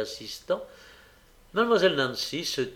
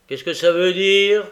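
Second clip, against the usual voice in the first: loud, raised challenge; dark, menacing; 5 semitones higher, 11 dB louder than usual. A narrator's reading. Sikes challenges Nancy's refusal, loud and dark. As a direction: demand an explanation forcefully. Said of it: "la regardant d'un air sombre".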